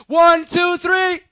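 high, loud count building to a shout. Real, not acted. Preacher counts the congregation into a loud blast. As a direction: pump the crowd up. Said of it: "one blast on the count of three".

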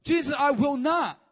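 high, loud flat refusal; insistent shout. Acted. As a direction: refuse hard, full voice. Peter insists to Jesus.

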